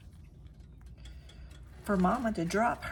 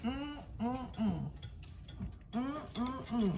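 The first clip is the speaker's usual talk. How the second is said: savoring hum; 3 semitones higher, 10 dB quieter than usual. Real, not acted. Tasting food, savoring hum.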